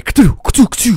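quick imitated camera-flash clicks, rising high; flashlight noise. Shown as a demonstration. Streamer showing viewers how fast to flash the light, with mock clicks. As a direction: mouth the flash sound fast. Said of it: "You gotta be rapid with that flash".

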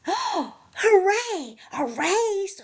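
high, loud excited hooray; cheering. A narrator's reading. Animals hear there will be a race, high cheering. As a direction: cheer like a happy crowd. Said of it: "Everyone cheered".

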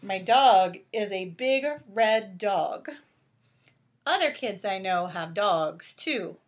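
lightly animated storybook narration; reading to children. A narrator's reading. Reading Clifford aloud to a child, gentle storybook voice. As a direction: read it out gently to little ones. Reads picture book aloud.

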